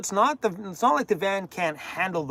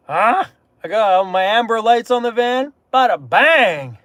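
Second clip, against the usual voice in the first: louder, proud showing-off; excited reveal; 5 semitones higher, 8 dB louder than usual. Real, not acted. Vlogger showing viewers his new van lights, excited. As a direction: present it with a flourish.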